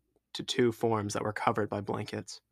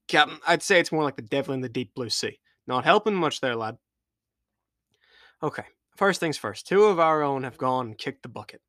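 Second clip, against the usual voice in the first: louder, higher rugged captain; rally the crew despite grief; captain taking charge of his stranded crew; pirate captain; 3 semitones higher, 5 dB louder than usual. Acted.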